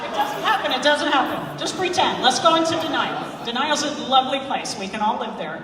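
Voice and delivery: loud playful reassurance over a laughing room; hammed-up cover for a blurted spoiler; mock denial. Joking denial of film spoiler.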